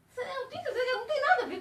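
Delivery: high, voice on the edge of tears; fighting back tears; tearful. Real, not acted. Emotional memory of dead mother. (com a voz embargada)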